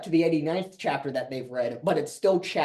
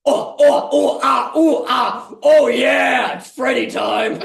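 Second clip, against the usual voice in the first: hammed-up moaning; 6 semitones higher, 7 dB louder than usual. Mock moaning ghost sex.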